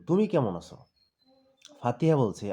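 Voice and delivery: ordinary delivery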